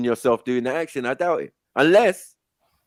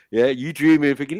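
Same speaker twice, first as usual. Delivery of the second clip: ordinary talk, nothing marked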